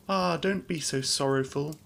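high-pitched, gently acted appeal; pleading cry. A narrator's reading. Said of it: "cried Beauty".

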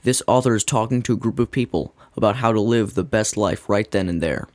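ordinary talk, nothing marked

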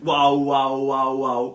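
loud, sustained mimicked wails; drawn-out mock yelling; imitated screaming. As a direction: imitate repeated screams at full volume. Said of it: "ela não tá a gritar isto".

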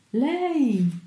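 high, drawn-out cry of recognition; frantic, high-arching exclamation; crazed exclamation. A narrator's reading. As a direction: cry out at a sudden sighting. Crazed officer sees her in sea.

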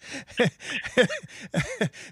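hearty high-pitched chuckling; laughter. Real, not acted. Host amused by guest's minority experience, laughing. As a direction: burst out chuckling, high and helpless.

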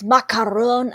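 "macaroon" with a French-style "-on" ending; French accent. Shown as a demonstration. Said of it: "He says it all Frenchy".

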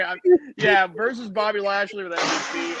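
ordinary talk

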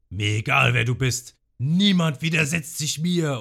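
screaming. Retold or imitated. Don Creek roars at the defiant cooks, furious scream. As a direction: bellow it like an enraged tyrant. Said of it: "fängt nämlich auf einmal an zu schreien".

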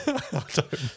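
high, laughing. Real, not acted. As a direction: laugh mid-word. Laughs at gravy joke.

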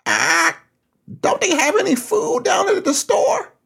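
shrieking parrot voice, very high and strained; dying parrot's gasp. Acted. Dying parrot gasps its last words to the woman, shrieked very high. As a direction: squawk it out as a starving parrot with its last breath. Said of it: "He gasped".

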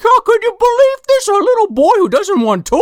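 very high, incredulous Santa; hammed-up shock at the letter; mock disbelief. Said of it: "He's like".

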